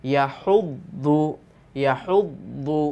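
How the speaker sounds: ইয়াহুদ্দু drawn out slowly with the দ্দ doubled; slow classroom repetition of ইয়াহুদ্দু; slow drill. Shown as a demonstration. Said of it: "দদ পেশ দু".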